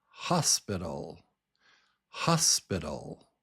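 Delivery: "hospital" as HOS-biddle, strong front; first syllable strong. Shown as a demonstration. Teacher models English stress, "hospital" strong on the first syllable. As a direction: hit HOS, let the rest of "hospital" drop. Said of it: "The first syllable is strong".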